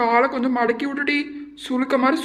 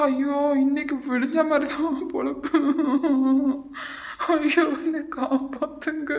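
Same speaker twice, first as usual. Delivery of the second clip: drawn-out cry for help with laughter in it; half-laughing mock wail; slower than usual. Victim begs to be saved from punishment, half-laughing wail. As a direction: wail slowly, somewhere between laughing and crying. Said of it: "நீ சிரிக்கிறியா அழுவுறியாட்டி".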